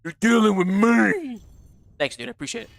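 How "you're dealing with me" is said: very drawn-out, raised playful warning; milk every word of a pretend threat; hammed-up protector, slow and swelling; mock threat. Mock threat repeated, stretched out.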